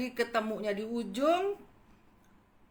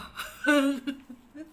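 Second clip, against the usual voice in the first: laugh; 6 semitones higher, 4 dB louder than usual. Real, not acted. Laughing at own gossip.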